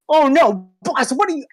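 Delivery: high squeaky startled goblin. Acted. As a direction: yelp it like a panicked goblin. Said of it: "the goblin goes".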